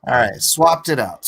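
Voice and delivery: normal talking voice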